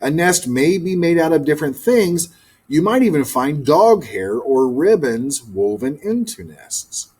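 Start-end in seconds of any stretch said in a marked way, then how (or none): none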